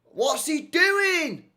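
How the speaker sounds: drawn-out high cry of disbelief. Real, not acted. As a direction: stretch out the doing in shock. Stunned reaction to a play.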